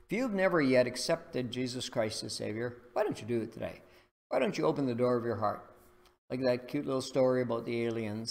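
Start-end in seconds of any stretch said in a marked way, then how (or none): none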